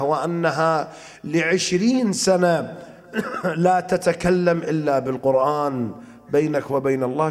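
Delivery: ordinary talk, nothing marked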